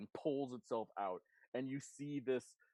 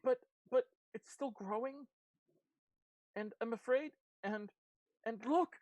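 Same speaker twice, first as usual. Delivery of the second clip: high, stammering, fearful voice; halting alarm, pitched well up; Blackberry, afraid; 10 semitones higher than usual. Acted. Blackberry warns that it is still growing, afraid.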